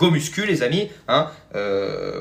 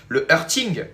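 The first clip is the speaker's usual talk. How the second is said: "hurting" with a French accent, French r sounded; 3 semitones higher, slower than usual. Shown as a demonstration. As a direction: say "hurting" in a thick French accent, ringing final g. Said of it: "je vais le faire avec un accent français". (accent français sur « hurting »)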